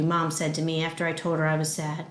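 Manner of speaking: plain reading voice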